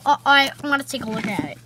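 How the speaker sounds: ordinary delivery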